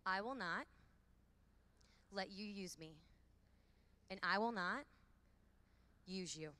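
WILL punched loud, pause between phrases; call and response. Shown as a demonstration. Speaker leading the women in a repeat-after-me pledge, stressing will. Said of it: "Repeat after me".

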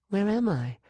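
put-on high, bewildered girl's voice; a lost child waking in a strange room; young girl Sean. A narrator's reading. Sean waking confused, asking the woman in a high voice. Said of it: "she asked".

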